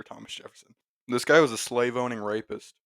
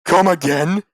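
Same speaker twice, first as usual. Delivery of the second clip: high, shouted challenge; loud, drunken demand; louder repeated question; 8 semitones higher, 12 dB louder than usual. Acted. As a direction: shout the question with a rising end. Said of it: "this time louder".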